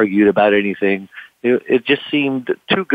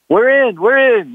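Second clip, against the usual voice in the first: high, louder excited chant; eager crew; 4 dB louder than usual. Retold or imitated. Crew agreeing to sail, high excited. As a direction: cheer like eager young crew.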